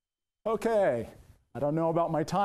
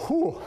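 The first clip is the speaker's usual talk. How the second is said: winded whew; 11 semitones higher, 3 dB louder than usual. Real, not acted. Hot and winded mid-workout.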